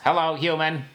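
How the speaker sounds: mock Martian greeting; Martian accent. Shown as a demonstration. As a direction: greet the human like a campy Martian. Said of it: "Martian accent is".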